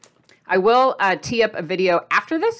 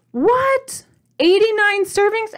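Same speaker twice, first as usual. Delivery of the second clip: shocked disbelief; 12 semitones higher, 4 dB louder, slower than usual. Real, not acted. Shocked at serving count.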